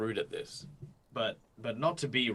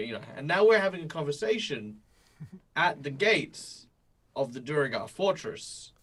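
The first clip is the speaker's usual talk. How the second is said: ordinary talk, nothing marked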